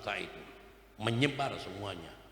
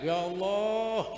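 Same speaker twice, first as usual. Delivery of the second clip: drawn-out cry; 8 semitones higher, 3 dB louder, much slower than usual. Shown as a demonstration.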